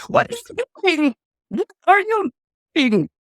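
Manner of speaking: high-pitched, alarmed shriek of a mother; horrified outcry. Acted. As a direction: cry out in acted panic, pitch shooting way up. Queen sees baby in fire.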